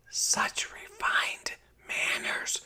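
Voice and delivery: slow, breathy whisper aside. A narrator's reading. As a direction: whisper it privately to Clara. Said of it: "Mama whispered to Clara".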